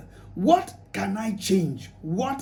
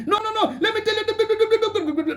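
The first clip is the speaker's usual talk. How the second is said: much higher, slow, heated wife refusing to calm down; angry wife; 11 semitones higher, slower than usual. Acted. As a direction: play the wife flaring up at her husband. Wife shouting in public.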